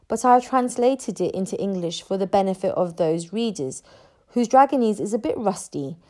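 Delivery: usual speaking voice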